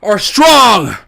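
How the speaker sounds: rising battle cry, loud and high; rally the troops, building to a roar; hype rallying shout. Real, not acted. Rallying chant for Greg heads.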